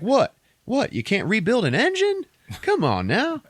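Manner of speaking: high, disbelieving hero; acted exasperated coaxing; mock incredulity. Hero's incredulous retort in retold film.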